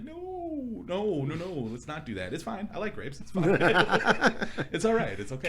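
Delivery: high, nervous pleading with laughs; panicked refusal. Retold or imitated. To the doctor about the nail, high nervous pleading. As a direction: beg the doctor off, voice jumping high. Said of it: "And I'm just like".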